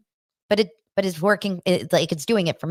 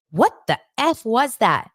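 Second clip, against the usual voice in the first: ordinary talk, nothing marked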